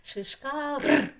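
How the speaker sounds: "Шишка р" slowly, ending in a long rolled "р"; emphasised final R. Shown as a demonstration. Teacher making children hear the sound, slow rolled "р" ending. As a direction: draw the word out and trill the last "р". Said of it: "є звук р".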